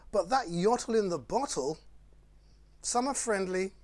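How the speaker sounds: lively, sing-song picture-book read; bouncy storytime rhyme reading; reading to children. Acted. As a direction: read it like bedtime fun for little ones. Reads Dr Seuss to children.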